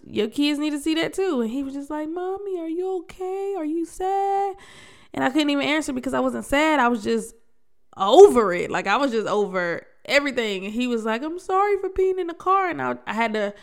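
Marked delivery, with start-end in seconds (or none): 2.1-4.6 put-on much higher, childlike voice; high-pitched, concerned kid voice; worried little son. Voicing her worried young son. 11.2-12.7 put-on high child voice; much higher, sorry-kid voice; apologetic little son. Voicing her son's apology.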